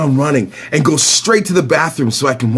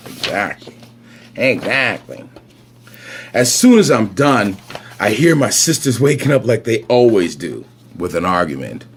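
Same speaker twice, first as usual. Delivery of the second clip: ordinary delivery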